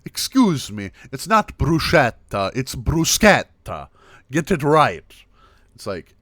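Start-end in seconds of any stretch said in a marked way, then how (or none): 0.0-5.0 mock outrage, loud and higher; indignant Italian corrector. Italian stops you over bruschetta, indignant mock correction. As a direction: scold loudly, punching the SK of bruschetta. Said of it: "Italians may stop you and go like".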